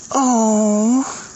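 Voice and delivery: high, crying oh; breaking into tears; real sob. Real, not acted. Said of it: "hang on, I'm having a moment".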